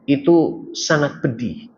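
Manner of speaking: normal talking voice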